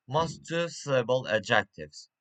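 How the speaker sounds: normal talking voice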